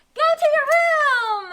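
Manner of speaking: high, loud, drawn-out yell; mad shout. Shown as a demonstration. Teacher shows an exclamation point, mad shouting. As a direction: shout it like an angry order. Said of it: "I'm shouting, or I'm very excited or mad".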